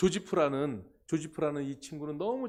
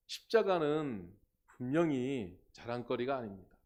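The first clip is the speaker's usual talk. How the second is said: normal talking voice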